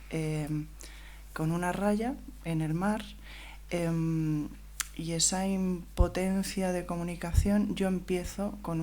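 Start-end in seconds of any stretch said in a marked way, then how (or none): none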